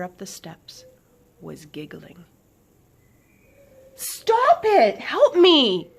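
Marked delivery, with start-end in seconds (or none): 3.9-5.9 much higher, loud angry yell; angry call. A narrator's reading. Swift angrily calling to her laughing sister. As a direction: snap it furiously up the steps. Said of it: "she called angrily towards Sadie".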